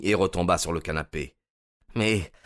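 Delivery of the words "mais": higher, dismayed protest; aghast, pitch raised; appalled exclamation. A narrator's reading. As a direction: realise the blunder aloud. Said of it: "s'exclama-t-il".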